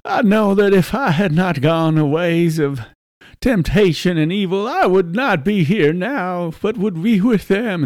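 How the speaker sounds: high-pitched, wavering plea of a condemned man; mock blubbering. Condemned man on the gallows lamenting his wife and children, mock blubbering. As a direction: blubber it out, hammed-up. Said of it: "one of them's all blubbering".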